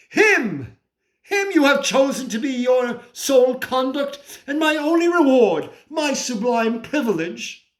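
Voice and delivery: high-pitched, bitter indignation; outraged disbelief. Acted. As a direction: cry out in outrage at God's choice. Rages at God's chosen favourite.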